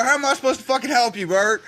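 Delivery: ordinary delivery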